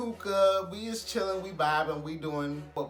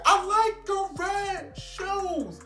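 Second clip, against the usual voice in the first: put-on high, slow, drunken yell; 13 semitones higher, 5 dB louder, much slower than usual. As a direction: yell it like he's deaf. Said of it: "I'm yelling too".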